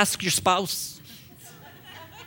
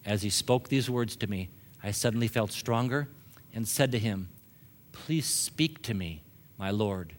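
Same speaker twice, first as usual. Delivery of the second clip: lowered, quiet voice with spaced phrases; soft scripture reading; 7 semitones lower, 7 dB quieter than usual. A narrator's reading. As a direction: read the verse softly and low to the congregation.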